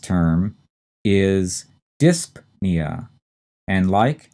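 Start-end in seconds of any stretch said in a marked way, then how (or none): 2.0-3.1 slow "dyspnea", DISP set apart from nea; stress on first syllable. Shown as a demonstration. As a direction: say "dyspnea" as DISP-nee-uh, pausing after P. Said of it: "the stress is in the first syllable".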